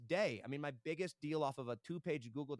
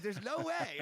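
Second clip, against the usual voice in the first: higher, louder, can't-believe-it squeal; a freelancer reacting to his own thirty grand quote, higher and louder in disbelief; stunned disbelief; 9 semitones higher, 3 dB louder, slower than usual. Telling the host how he reacted to sending a thirty grand proposal, squealing in disbelief.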